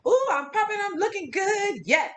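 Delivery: high, sing-out self-admiration; self-hyping confidence. Acted. Host acting out a day of feeling good, self-hyping. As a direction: hype yourself up in the mirror. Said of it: "where we are just feeling like".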